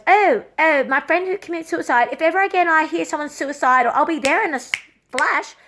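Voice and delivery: mock the hollow promise; mocking friend voice.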